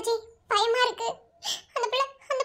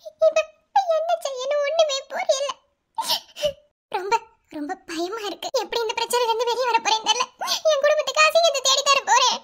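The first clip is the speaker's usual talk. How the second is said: tearful, with long sobbing breaks; words struggling out through tears; crying. Acted. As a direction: sob between phrases, frightened and helpless. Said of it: "ஏமா அழுதுட்டே இருக்கீங்க".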